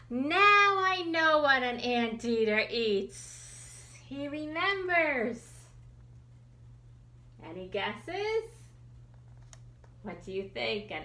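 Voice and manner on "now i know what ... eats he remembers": delighted discovery. Acted. Anteater realizes what it eats.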